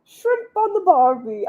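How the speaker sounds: high, slow mock-Australian accent; hammed-up Australian accent; bad Australian accent. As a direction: stretch it out in a bad Aussie accent. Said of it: "I can't do accents".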